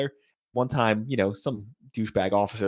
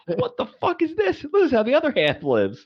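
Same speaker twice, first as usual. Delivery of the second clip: amazed disbelief; 3 dB louder than usual. Retold or imitated. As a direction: gasp at the luxury of the room. Amazed at officer's quarters.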